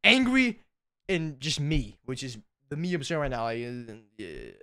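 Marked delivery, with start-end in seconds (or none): 0.0-0.6 a sudden loud burst on Angry, much higher; loud higher mock-angry Angry; angry side. Shown as a demonstration. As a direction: snap out the word Angry like the angry side breaking through. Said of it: "There's two sides of me".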